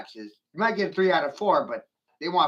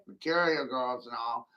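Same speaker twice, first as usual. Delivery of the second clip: ordinary delivery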